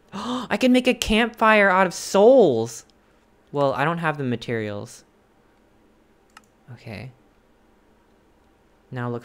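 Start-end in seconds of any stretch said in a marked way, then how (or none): 0.1-2.8 loud, slow amazed exclamation; excited. Real, not acted. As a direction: marvel at it. Gasping discovery.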